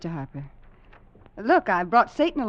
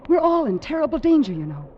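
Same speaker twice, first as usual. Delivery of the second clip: higher, urgent alarmed warning; fearful urgency; 9 semitones higher, 3 dB louder than usual. Acted. Frightened wife warns the mate, urgent alarm. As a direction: press the danger with fear.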